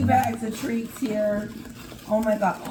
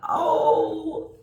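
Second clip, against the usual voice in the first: high, loud, tearful oh; overwhelmed, almost crying; teary gasp; 10 semitones higher, 4 dB louder than usual. Real, not acted. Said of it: "She's gonna make me cry".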